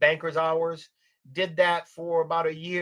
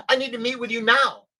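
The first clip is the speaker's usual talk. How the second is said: higher, louder retold demand; urgent demand; 7 semitones higher, 6 dB louder than usual. Client demands a Sunday meeting from her adviser, urgent demand.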